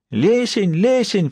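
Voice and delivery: put-on high thin repeated cry of a young girl; thin girl's call. A narrator's reading. Captive girl still calling Lesen for help, high thin cry. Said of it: "всё звал тонкий голос".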